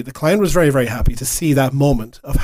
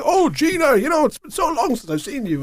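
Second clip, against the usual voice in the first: a light jolly Santa, pitched higher; a hint of Santa greeting an old friend; 10 semitones higher, 3 dB quieter than usual. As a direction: greet her warmly as Father Christmas. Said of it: "in my best Santa accent".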